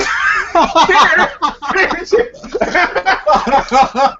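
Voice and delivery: big high burst of laughter; loud laugh. Real, not acted. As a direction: crack up loudly. Laughing at own whisper.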